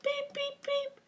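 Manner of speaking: piercing high beep beep beep; high alarm beeps. Retold or imitated. Parrot mimicking the house alarm, high beeps. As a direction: chirp out an alarm.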